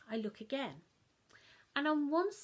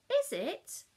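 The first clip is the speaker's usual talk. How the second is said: much higher, louder Mum; Mum sharp question; 8 semitones higher, 8 dB louder than usual. Acted. Mum spotting chocolate on child's chin, sharp. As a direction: pounce on the clue.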